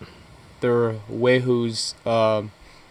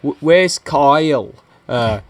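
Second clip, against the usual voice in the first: halting, louder mock-British voice; bad British accent; 3 semitones higher, 4 dB louder, slower than usual. Retelling a friend fumbling a prank call, clumsy British accent. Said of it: "better than what we were doing".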